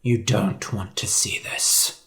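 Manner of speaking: soft grave whisper. A narrator's reading. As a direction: whisper to spare the boy. Hunter shields George from sight.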